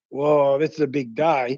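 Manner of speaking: slow, drawn-out amazement at a big day; retelling how far the shelf felt as kids, slow awe; mock awe. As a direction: act out the old thrill, slowly. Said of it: "That was like".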